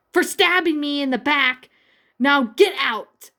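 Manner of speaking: snapping. A narrator's reading. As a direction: spit out each word, furious. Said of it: "Raven snapped at Starfire".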